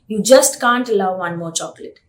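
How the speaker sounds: normal talking voice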